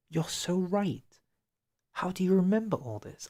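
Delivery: high-pitched, impressed exclamation; genuinely astonished, pitch jumping up; real amazement. Real, not acted. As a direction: marvel at someone's memory, voice lifted high. Amazed at a viewer's memory.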